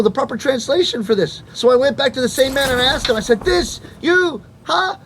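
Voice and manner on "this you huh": much higher pitched excited asking; hammed-up eager customer; mock excited self. Himself retold to shop man.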